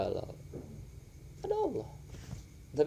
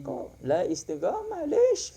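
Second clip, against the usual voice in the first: slow, then a sudden high squeak on "lish"; mock scolding; 13 semitones higher, slower than usual. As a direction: tease them like a scolding friend.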